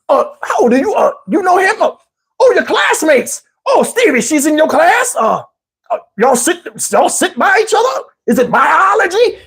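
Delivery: a hint of a nosy old man prying; granddad voice.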